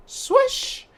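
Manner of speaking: high loud swish sound; high swoosh effect; sword swish. Acted. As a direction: whip out a sword noise.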